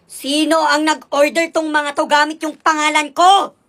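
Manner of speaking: slow, loud, deliberate scolding; stern mother demanding answers; angry demand. Acted. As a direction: grill the kids one word at a time. Mother interrogating her kids.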